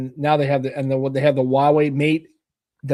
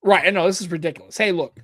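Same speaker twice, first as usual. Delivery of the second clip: ordinary delivery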